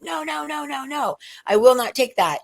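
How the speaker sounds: high voice pushing it away; lightly acted stubborn refusal. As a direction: beat the love off with a stick. Dark soul fighting off love.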